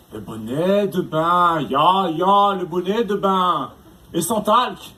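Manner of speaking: put-on slow, drawn-out German-accented menace; mock German accent. Torturer threatening with a bathing cap, slow mock German accent. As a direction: savour each word as a hammy German villain.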